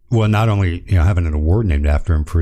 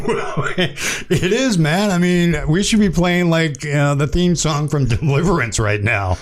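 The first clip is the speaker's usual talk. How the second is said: laughing; 9 semitones higher than usual. Real, not acted. Host still laughing at call.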